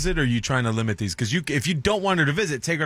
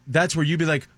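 ordinary talk

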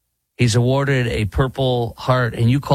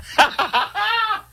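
laugh. Real, not acted. Laughs at his bad impersonation.

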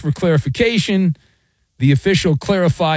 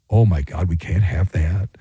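sarcastic mock shock; 10 semitones lower than usual.

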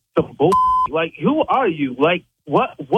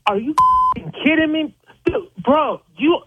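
real outraged disbelief; 9 semitones higher than usual. Real, not acted. Victim hears the demand.

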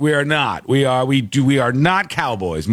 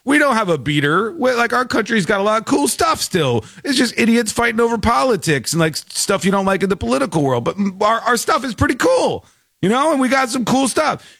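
higher, upbeat voicing of proud Americans; mock eager Americans; 7 semitones higher than usual. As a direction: sell it like a cheery patriot, higher. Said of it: "where people are like".

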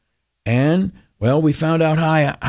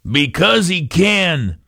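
grandpa punchline; 5 semitones higher, slower than usual. Retold or imitated.

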